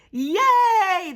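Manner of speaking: very high, loud hurrah; cheer. Acted. Crowd celebrating, loud high cheer. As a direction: whoop for joy. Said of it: "the crowd cheered".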